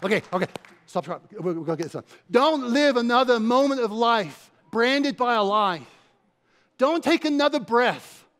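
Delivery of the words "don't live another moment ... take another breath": preaching climax. Real, not acted. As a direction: drive it home to the congregation.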